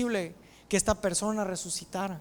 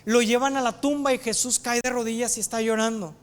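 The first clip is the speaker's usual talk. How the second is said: ordinary talk, nothing marked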